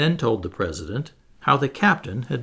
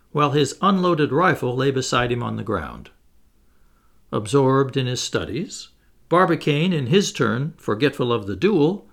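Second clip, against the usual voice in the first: ordinary delivery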